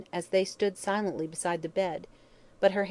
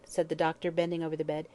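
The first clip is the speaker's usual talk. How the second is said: ordinary delivery, nothing marked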